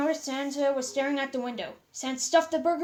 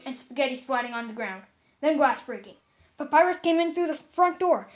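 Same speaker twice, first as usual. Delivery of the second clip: usual speaking voice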